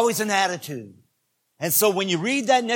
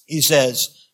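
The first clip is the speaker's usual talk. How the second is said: normal talking voice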